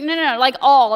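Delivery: loud, firm insistence on all; emphatic insistence. Retold or imitated. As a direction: hammer home the word all. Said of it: "And it's like".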